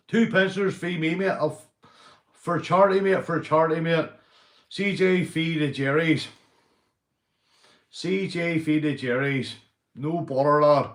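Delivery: fired-up call-out for charity; loud call-out. Real, not acted. Calling out rivals to fight.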